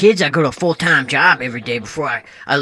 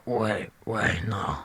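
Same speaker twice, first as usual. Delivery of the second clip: whisper; 9 semitones lower, 12 dB quieter, slower than usual. Acted. Aside to himself.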